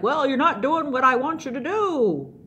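hammed-up exasperated scolding; annoyed Balaam. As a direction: argue back in comic indignation. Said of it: "Balaam's just like".

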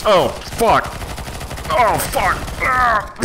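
high alarmed swearing; startled high-pitched curses; panicked cursing. Real, not acted. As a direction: yelp in panic as the shots hit. Panicked in firefight.

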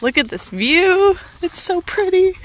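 high, drawn-out gush over the view; delighted admiration. Real, not acted. Vlogger showing viewers a pretty view, delighted admiration. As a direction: gush with rising pitch.

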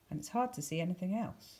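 ordinary delivery, nothing marked

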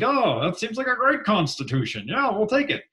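high-pitched, easily impressed quote; voiced president's approval. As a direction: play a president cheerfully waving it through. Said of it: "is like".

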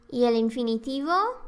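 normal talking voice

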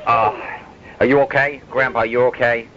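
ordinary talk, nothing marked

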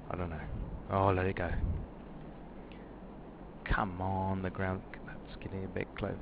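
normal talking voice

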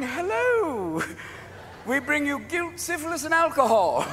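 high-pitched hailing arrival; Puritan settler's greeting. Acted. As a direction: sing out a high, cheery-sounding hello to strangers. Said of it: "they land in America going".

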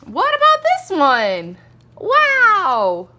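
slow, loud, high wow; excited. Real, not acted. Mom shows new toy truck.

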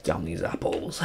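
low, soft whisper to self; hushed, voiceless muttering; whispered aside. Real, not acted. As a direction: whisper it under your breath. Muttering to self while playing.

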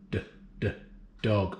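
whispered d, d then dog; sounding out words. Shown as a demonstration. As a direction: isolate the d in dog. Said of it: "what does dog start with".

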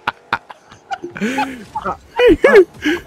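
high, loud shrieking laugh. Real, not acted. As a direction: burst into a squealing laugh. Wild laughter at game moment.

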